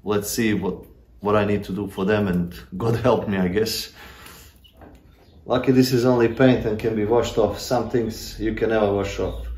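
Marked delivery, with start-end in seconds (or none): none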